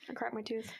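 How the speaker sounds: breathy whisper. Real, not acted. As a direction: whisper it, mouth hurting. Repeats cracked tooth complaint.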